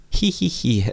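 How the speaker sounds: put-on high girlish hee-hee giggle; shrill giggle. A narrator's reading. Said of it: "закатилась пронзительным смехом".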